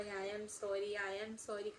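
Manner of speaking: whisper-close plea, fading out; desperate quiet sorry. Retold or imitated. Girl to the aunt, softly begging sorry. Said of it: "इतना गिड़गिड़ा रही थी".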